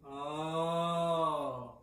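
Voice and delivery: long yawny "oh"; back-resonant oh. Shown as a demonstration. Voice teacher showing the back space, yawny oh. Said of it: "Is the back".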